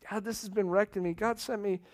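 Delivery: ordinary talk, nothing marked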